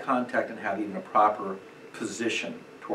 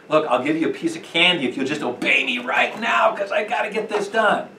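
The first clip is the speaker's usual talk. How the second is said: loud, higher mock shout of a parent bribing a child; hammed-up desperate bribe, called out loud; bartering parent; 5 semitones higher, 6 dB louder than usual.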